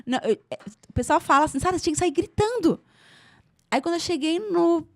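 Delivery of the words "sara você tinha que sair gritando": higher, quicker quoted exclamation; exclaimed advice. Retold or imitated. Friends telling Sara she should have left yelling, exclaimed. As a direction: imitate friends urging her, higher and fast. Voices people's shocked advice.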